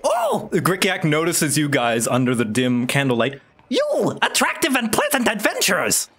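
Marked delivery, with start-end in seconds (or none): 3.7-6.1 high, squeaky goblin. Acted. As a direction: chirp like an excited goblin. GM voices goblin merchant.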